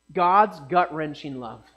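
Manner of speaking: God's gut-wrenching love, slow and weighty, stress on God's; slow, deliberate definition with a pause before it; defining phrase. Shown as a demonstration. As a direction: lay down the definition word by word. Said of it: "the way I like to define it".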